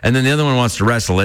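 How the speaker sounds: ordinary talk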